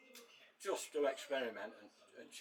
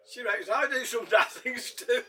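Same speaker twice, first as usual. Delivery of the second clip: higher and louder through laughter; laughing; 11 semitones higher, 12 dB louder than usual. Real, not acted. Laughing about his wife.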